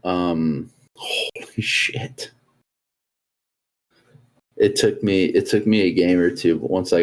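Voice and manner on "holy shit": slow breathless whisper; whispered awe. Real, not acted. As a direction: hush it out in awe. Amazed at the missile mode.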